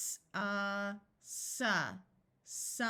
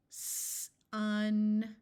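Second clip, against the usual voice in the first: usual speaking voice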